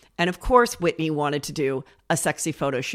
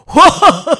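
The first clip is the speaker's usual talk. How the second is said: laughing outburst; 11 semitones higher, 16 dB louder than usual. Real, not acted. Bursts out laughing.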